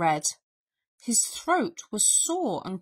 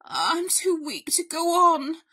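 high, melodramatic invalid bear; self-pitying, hammed-up bear; huffing bear; 8 semitones higher, 4 dB louder than usual. A narrator's reading. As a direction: huff as if at death's door. Bear claims weakness.

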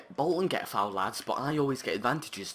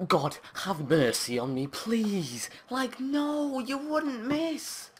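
high, slow pleading wail; high wailing plea; anguished pleading; 13 semitones higher, 4 dB louder, slower than usual. Real, not acted. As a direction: beg the heavens. Pleading then disbelief.

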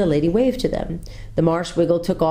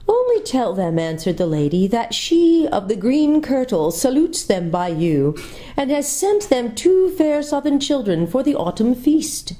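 sweet, high, gracious lady's voice; 7 semitones higher than usual. A narrator's reading. Lady coaxing the tired children, sweet and gracious. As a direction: charm the children with courteous welcome.

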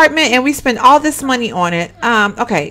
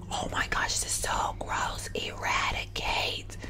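slow, grossed-out whisper; 19 dB quieter, slower than usual. Real, not acted. Player appalled by roaches in the game, whispering. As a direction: whisper it very softly, drawing words out. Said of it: "Yes, I'm whispering".

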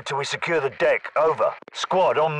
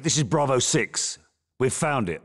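ordinary delivery

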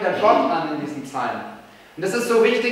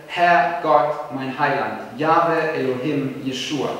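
quiet prayer; 5 semitones lower, slower than usual. Shown as a demonstration. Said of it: "der Schrei deiner Seele".

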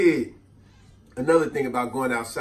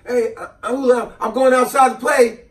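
put-on louder, higher eager kid; 8 semitones higher, 7 dB louder than usual. Kid tells his aunt he is going out, eager. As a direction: announce it happily. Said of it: "I said".

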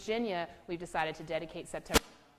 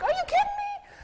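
much higher and louder, incredulous; shocked disbelief; 11 dB louder than usual. Retold or imitated. Grandma stunned to hear she scares people, high and loud. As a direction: shoot up in disbelief.

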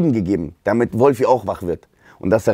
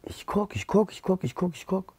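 murmured searching; 7 semitones higher, 11 dB quieter than usual. Retold or imitated.

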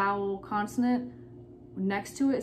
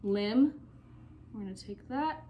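ordinary talk

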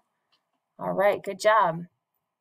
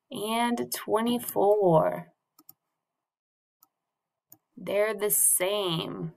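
drawn-out teacher emphasis; slow and deliberate; much slower than usual. Shown as a demonstration. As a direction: stretch each word slowly. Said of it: "teacher stressing sameness".

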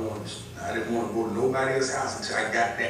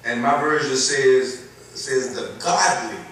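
scripture reading; 2 semitones higher, 4 dB louder, slower than usual. Acted. Slow emphatic scripture reading.

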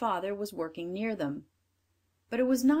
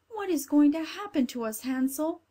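put-on higher, louder anxious child; a scared little sister; frightened little girl; 7 semitones higher, 5 dB louder than usual. Gretel voiced frightened.

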